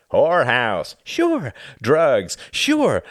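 loud, punched, high-flung words; angry sarcasm. Acted. Tom listing his friend's excuses, bitterly sarcastic. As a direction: fire each item out scornfully. Tom lists grievances angrily.

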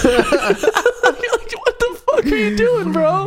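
high, drawn-out incredulous laughing; laughing mock disbelief. Onlooker watching the ice pick stunt, high laughing disbelief. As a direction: squeal it through laughter, stretched out. Freaked-out onlooker, speaker cracking up.

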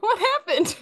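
high-pitched what happened through laughter; laughing disbelief. Real, not acted. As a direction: squeak it out mid-laugh. Laughing confusion at call drop.

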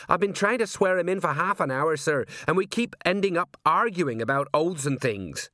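high, harried explanation; exasperated sergeant. Acted. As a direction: complain upward to the boss.

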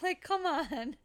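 crying with laughter, high; laughing. Real, not acted. Mom laughing about her son's mix-up, tearing up. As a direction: laugh it out helplessly.

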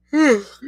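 long sleepy yawn; loud tired yawn. Real, not acted. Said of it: "sorry for yawning so much".

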